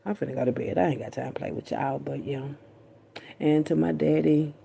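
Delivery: ordinary delivery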